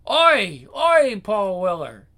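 loud, drawn-out yobbish call; shouted heckle. Acted. Scooter gang yelling at Paul Weller, loud drawn-out shout. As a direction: bellow it at someone across the street. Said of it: "and shouts".

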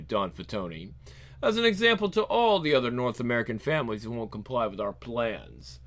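low, soft threat; cold villain. Acted. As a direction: state a death threat calmly. Villain announcing murder.

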